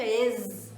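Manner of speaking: "es" said as ez with a buzzing final z; closed e followed by an audible z in "es"; Z sounded. Shown as a demonstration. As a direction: let the z ring at the end of "es". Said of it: "mas você não escuta es".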